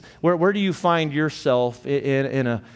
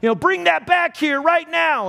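much higher, louder retold shout; yelling from the porch; 5 dB louder than usual. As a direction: bellow at the bike thief to bring it back. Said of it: "she yelled from the porch".

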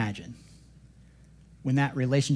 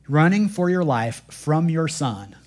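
ordinary talk, nothing marked

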